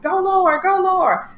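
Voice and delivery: high, loud mocking trainer bark; mock coach. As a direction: push her like a pushy coach, hammed up. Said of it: "And he's kinda like".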